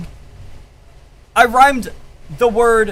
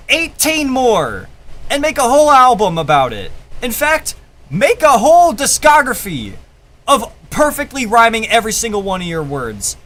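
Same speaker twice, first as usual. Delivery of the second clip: ordinary delivery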